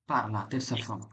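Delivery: ordinary talk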